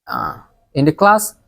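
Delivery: ordinary delivery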